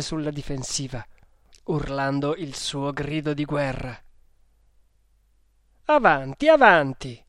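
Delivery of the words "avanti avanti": loud repeated battle cry; forceful, daring shout; thundered challenge. A narrator's reading. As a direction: call the enemy on, falling on the repeat. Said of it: "tuonò".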